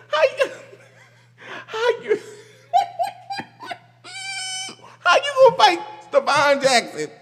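can barely get it out for laughing; laughing disbelief. Real, not acted. Laughing at a fan trying to fight Stephon Jackson, in disbelief.

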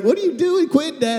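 put-on high whiny kid voice; high pleading protest; complaining kid. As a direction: whine it like a little boy. Son complaining in retold game.

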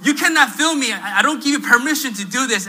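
high, loud acted protest; angry yelling. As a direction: imitate a heated shouting match. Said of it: "like, yelling at each other".

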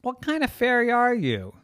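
put-on high, loud kid challenge; high-pitched incredulous question; indignant girl. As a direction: demand it in a high child's voice.

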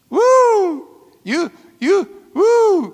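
high, loud gleeful whooping; excited whoops. Acted. Preacher imagining the rapture ride, whooping loud.

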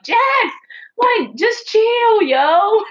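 high, loud, slow drawn-out exasperated yell; inner scream. Pretend. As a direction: let the bottled-up frustration burst out. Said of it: "I wanna scream and be like".

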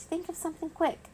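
high-pitched panicky squeak; little creature's urgent high voice; squeaky voice. A narrator's reading. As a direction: squeak it out in a hurry. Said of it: "he squeaked".

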